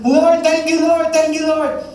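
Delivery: very high, loud repeated praise; overflowing joy, thanking again and again; ecstatic thanks. Acted.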